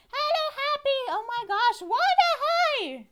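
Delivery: very high, loud squealing greeting; excited squeal. Real, not acted. Streamer greeting Happy on arrival, excited high squeal. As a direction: squeal hello in delighted surprise.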